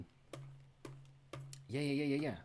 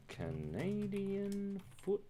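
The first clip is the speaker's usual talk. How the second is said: very slow, each syllable drawn out; "Canadian foot-" sounded out slowly to type it; dictating while typing; 8 semitones higher, 4 dB quieter, much slower than usual. Shown as a demonstration. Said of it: "don't spell it wrong".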